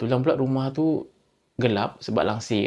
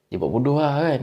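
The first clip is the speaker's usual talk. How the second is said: ordinary delivery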